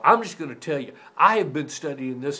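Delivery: loud, looming lecture voice; lecturing from above. Retold or imitated. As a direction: talk down at him like a know-it-all standing over him. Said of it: "he would stand up and look down on me and go".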